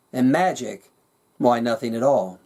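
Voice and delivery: plain reading voice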